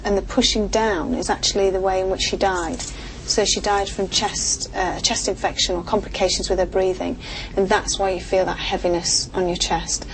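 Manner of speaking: ordinary talk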